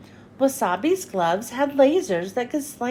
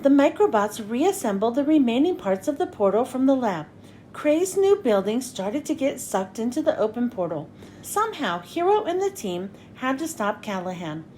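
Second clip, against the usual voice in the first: usual speaking voice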